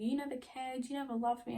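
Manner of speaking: high whiny mock sulk of the boy; mock self-pity. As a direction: mimic him like a wounded drama queen. Quoting his self-pitying text mockingly.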